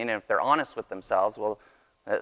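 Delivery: ordinary talk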